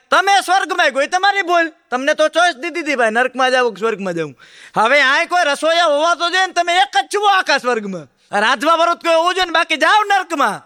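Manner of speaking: high, lecturing heaven attendant; scolding attendant. Acted. Attendant telling the man it's his own fault, high and scolding. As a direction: rub it in like a know-it-all servant.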